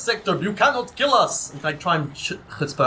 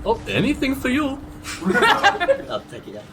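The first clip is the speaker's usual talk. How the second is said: flirty vampire voice; 7 semitones higher than usual. Acted. Dracula flirting back in character.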